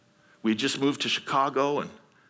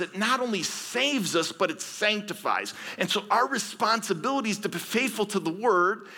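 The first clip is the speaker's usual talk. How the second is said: preaching force; 8 semitones higher than usual. Real, not acted.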